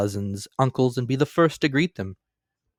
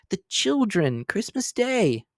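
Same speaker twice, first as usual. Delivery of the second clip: higher, slow, gently reproachful; mild pleading husband; 10 semitones higher, slower than usual. A narrator's reading. Husband calming his angry wife, slow mild plea. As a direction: soothe her, drawing out Christmas Day. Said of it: "was Bob's mild answer".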